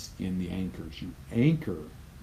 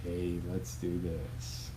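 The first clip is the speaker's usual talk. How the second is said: flat, unexcited; 2 semitones lower, 9 dB quieter than usual. Pretend. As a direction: say it like you don't mean it. Mocks low-energy race or meeting start.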